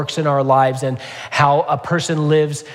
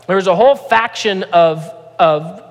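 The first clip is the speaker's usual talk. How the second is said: preaching at full force; 5 semitones higher than usual. Acted. Pastor warning the church about man-made rules, preaching with rising force. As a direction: preach it out, pressing harder line by line.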